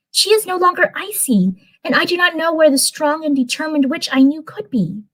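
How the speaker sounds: high put-on Stormy. Streamer voicing Stormy complaining about Icy, in a high voice.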